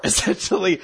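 usual speaking voice

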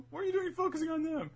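high, fast baffled outburst; high-pitched mock bafflement; fast exasperated question. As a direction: rattle it off in disbelief. Sai questioning grandfather.

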